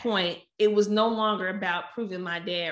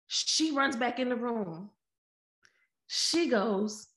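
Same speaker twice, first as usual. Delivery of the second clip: normal talking voice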